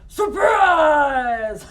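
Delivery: loud, high "Surprise!"; villain shout. Acted. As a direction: burst in and yell it. Wolf barges into grandma's house.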